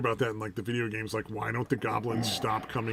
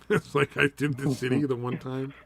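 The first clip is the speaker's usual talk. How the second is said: usual speaking voice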